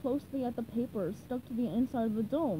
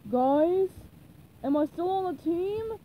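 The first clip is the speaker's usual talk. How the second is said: raised, hopeful calling; higher questioning call; Patrick; 5 semitones higher, 4 dB louder, slower than usual. A narrator's reading. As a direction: call after friends, rising at the end. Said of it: "Patrick called".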